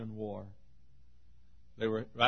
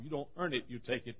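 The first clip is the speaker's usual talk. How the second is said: ordinary talk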